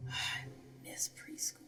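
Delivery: soft breathy sigh, then a whisper. Acted. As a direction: sigh it wistfully under your breath. Wistful aside after joke.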